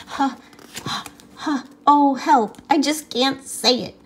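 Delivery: high breathless laughing words; laughing too hard to talk. Acted. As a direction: gasp it out between laughs. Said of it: "I'm laughing so hard".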